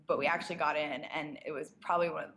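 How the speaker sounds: usual speaking voice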